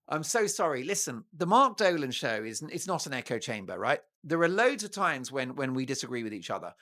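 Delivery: ordinary delivery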